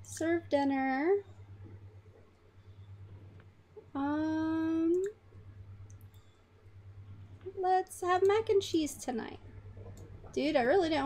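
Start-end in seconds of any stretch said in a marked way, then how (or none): 0.0-9.4 lightly higher mom voice; a hint of a cheerful mother. Mom telling her son about dinner, slightly higher. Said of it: "She's like".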